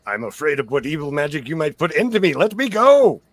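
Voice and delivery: quick protest climbing to a high, loud demand; rising, indignant old man's voice; agitated old man. Acted. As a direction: play an alarmed old captive demanding release.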